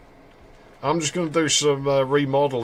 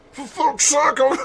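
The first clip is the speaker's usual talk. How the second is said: high, louder exasperated curse in a put-on voice; hammed-up exasperation, much higher; mock frustrated partner; 14 semitones higher, 6 dB louder than usual. Game partner cursing at Chris for wandering off.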